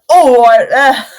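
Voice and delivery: hammed-up West Country accent, loud; a bad West Country baker's voice; mock West Country yell; awful West Country. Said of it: "awful, terrible West Country accent".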